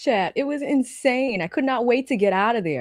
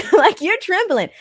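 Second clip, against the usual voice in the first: laughing; 8 semitones higher, 6 dB louder than usual. Real, not acted. Laughing while retelling.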